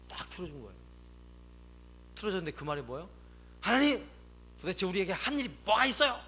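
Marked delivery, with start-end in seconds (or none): 3.6-6.2 high, loud protest to God; acted defiant outburst; indignant complaint. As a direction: cry out accusingly, exasperated. Replays people's complaint to God.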